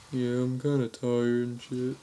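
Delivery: lightly voiced sleepy man, slowed; slow, weary retold reply; tired prank victim. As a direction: imitate a man woken in the night. Acting sleepy man woken at night.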